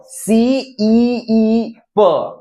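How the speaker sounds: सी ई ई प with the ee held very long and slow; long ee demo. Shown as a demonstration. Teacher showing how double ee lengthens, very slowly. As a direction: draw out सी ई ई प, ee stretched over three beats. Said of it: "यानी तीन बार ई".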